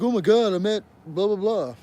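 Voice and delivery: high, loud mock excitement; imitated gushing fans. Imitating people who met the players, mock excited. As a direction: gush like a starstruck fan, hammed up. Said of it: "Other people would be like".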